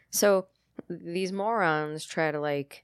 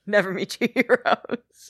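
laughing; 7 semitones higher, 9 dB louder than usual. Real, not acted. Laughing repeat of advice.

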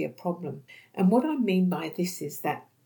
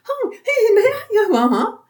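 much higher, louder, chirpy laughing nonsense; mumbling nonsense, laughing; 11 dB louder than usual. Retold or imitated. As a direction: imitate the lady's happy jumbled chatter. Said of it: "So she would mumble".

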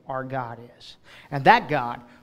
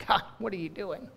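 hushed despairing question; 2 semitones higher, 9 dB quieter than usual. Acted. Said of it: "not the way it was supposed to go".

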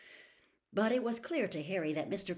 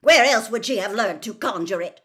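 loud, higher, outraged question; angry shout; 5 semitones higher, 14 dB louder than usual. A narrator's reading. Mister Crouch challenging Diggory, shouting. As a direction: demand it at a shout. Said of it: "shouted Mister Crouch".

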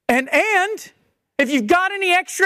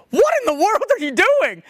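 higher-pitched, incredulous challenge; mock disbelief; 6 semitones higher than usual. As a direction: act appalled at what they just did. Acted shock at interrupted weeping.

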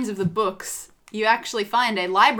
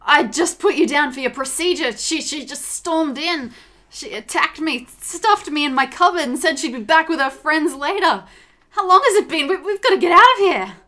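higher, breathless, agitated account; panicked doctor; 6 semitones higher, 5 dB louder than usual. A narrator's reading. Freed doctor telling rescuers of the attack, panicked. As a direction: stammer it out, building to louder urgency.

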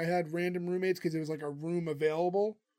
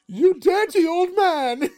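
higher, louder, slow, laughing outburst; indignant shout; 11 semitones higher, 9 dB louder, much slower than usual. Retold or imitated. Patty yelling at Roper, retold.

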